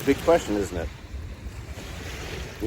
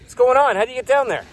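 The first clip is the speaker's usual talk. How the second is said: high, raised friendly call; surprised shout into a hole; yelling down; 8 semitones higher than usual. Acted. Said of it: "they yell down in that hole".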